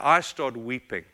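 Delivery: ordinary talk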